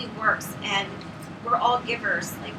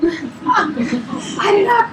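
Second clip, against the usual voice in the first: laughing through tears; 10 semitones higher, 9 dB louder, much slower than usual. Real, not acted. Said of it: "I'm gonna get teary-eyed".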